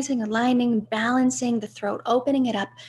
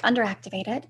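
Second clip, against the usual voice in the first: usual speaking voice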